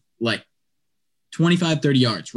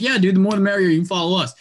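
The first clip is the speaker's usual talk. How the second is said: eager yes; 6 semitones higher, faster than usual. Retold or imitated. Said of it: "And I'm like".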